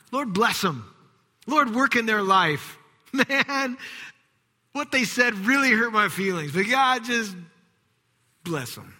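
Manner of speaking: half-laughing reluctant prayer; grudging prayer. Retold or imitated. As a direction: force out a prayer through hurt. Reluctant prayer, hurt feelings.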